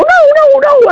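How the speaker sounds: very high scared no no no; frightened refusal. Retold or imitated. Kids locked in the car refuse their mother, high and scared.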